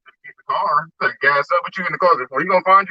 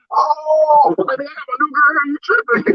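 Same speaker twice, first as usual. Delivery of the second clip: high put-on voice, mock sheepish; mock husband; 11 semitones higher, 3 dB louder, slower than usual. Husband caught with his new girlfriend, mock sheepish voice.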